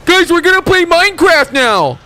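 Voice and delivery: hammed-up excitement; mock hype. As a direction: shout it like an overexcited kid streamer. Mock hype announcing Minecraft.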